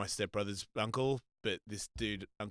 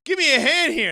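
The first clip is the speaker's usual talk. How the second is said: high, loud call for help; mock urgent yell for a hand; 13 dB louder than usual. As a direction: shout it up high like a man struggling. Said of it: "he's like".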